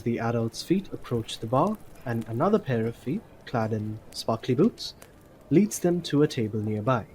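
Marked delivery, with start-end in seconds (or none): none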